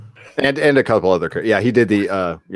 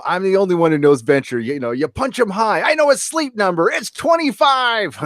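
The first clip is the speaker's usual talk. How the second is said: rising ever higher, slowing to a squeak; bragging Monarch; 10 semitones higher than usual. Retold or imitated. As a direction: climb into a silly boastful squeak.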